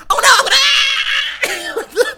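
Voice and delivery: high hammed-up screaming panic; mock cartoon scream. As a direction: scream like a bad cartoon actor. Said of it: "And he falls off".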